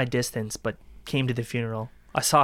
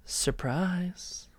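mocking whisper; 5 semitones higher, 7 dB quieter than usual. Killer gloating.

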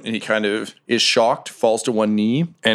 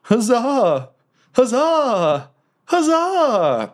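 forced cheer; 13 semitones higher, much slower than usual. Acted. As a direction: squeal the huzzahs under duress. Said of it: "Poor Passark in your arms is like".

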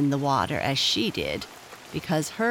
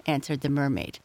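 ordinary delivery

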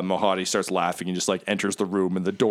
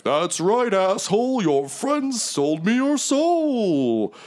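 high, slow gloating devil; theatrical villain gloat, pitched way up; Mahadi; 12 semitones higher, much slower than usual. Acted. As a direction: crow it, stretching the last word. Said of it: "Mahadi's like".

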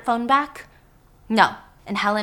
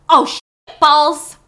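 pained curse; 5 semitones higher, 10 dB louder, slower than usual. Acted. Hot sauce burning his eyes.

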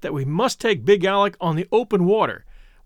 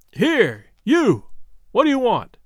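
rough fisherman yelling across the water; shouted hail; 4 semitones higher, slower than usual. A narrator's reading. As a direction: hail the boat from afar, pitched high. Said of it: "he shouted".

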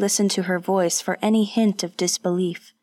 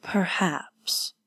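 a soft, unvoiced, drawn-out perhaps; slow, quiet perhaps; 5 dB quieter than usual. A narrator's reading. Warrior answering her offer hesitantly, slow and quiet. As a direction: say it slowly under your breath. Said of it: "He spoke slowly".

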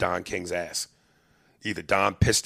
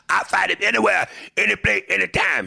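loud, higher mock fighter boast; Union Kane's challenge; 12 semitones higher, 5 dB louder than usual. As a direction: bark the challenge like a hungry contender. Boxer interrupts manager in limo.

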